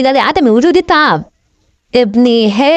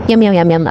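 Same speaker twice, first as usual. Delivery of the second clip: low munching yums; 7 semitones lower than usual. Acted. Pet voiced eating food.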